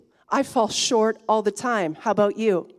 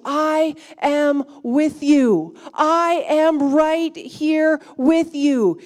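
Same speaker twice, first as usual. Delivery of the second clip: slow reassurance; 5 semitones higher, 7 dB louder, much slower than usual. Acted. Voice of Jesus, slow.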